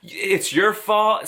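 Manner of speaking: a hint of a condescending phone agent; rude mockery. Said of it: "extremely rude still".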